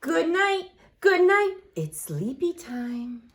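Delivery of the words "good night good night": ordinary talk, nothing marked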